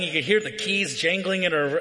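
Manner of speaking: normal talking voice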